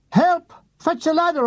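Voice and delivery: high yell up at a passing plane; shouting for help. Retold or imitated. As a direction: holler for help into the sky. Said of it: "I shouted at it".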